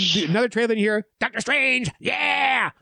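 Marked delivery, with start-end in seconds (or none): 1.2-2.7 put-on fanboy voice; front-row heckler voice. Imitating the jackass at another trailer, heckling voice. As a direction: imitate an overeager moviegoer cheering. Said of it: "he's doing it in that voice".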